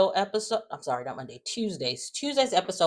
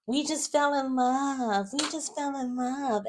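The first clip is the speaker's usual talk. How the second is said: high, slow, mock-dreamy lover's excuse; mocking lovestruck voice; 9 semitones higher than usual. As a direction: parody her innocent "we just fell in love". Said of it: "And you're acting like".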